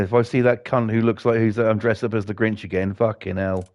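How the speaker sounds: ordinary talk, nothing marked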